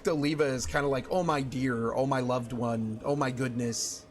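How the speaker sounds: usual speaking voice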